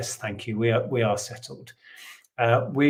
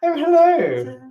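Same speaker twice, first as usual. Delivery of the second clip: high, loud posh lady greeting; 7 dB louder, slower than usual. Shown as a demonstration. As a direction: play the lady of the manor. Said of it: "Just put on a posh voice".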